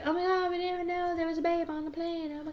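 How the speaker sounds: high, gushing imitation of amazed strangers; mock amazement. Imitating amazed passengers to her friends, high and gushing. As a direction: gush like a stunned passenger.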